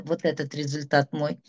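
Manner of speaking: ordinary talk, nothing marked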